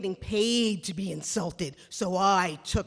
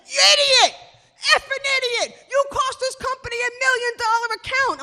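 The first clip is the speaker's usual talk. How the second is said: much higher and louder screaming; screaming boss; 14 semitones higher, 10 dB louder than usual. Retold or imitated. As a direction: scream at the employee.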